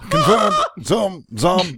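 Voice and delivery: high, loud cheering chant; excited shout. Real, not acted. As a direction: cheer each word out.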